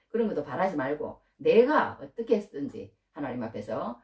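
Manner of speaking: usual speaking voice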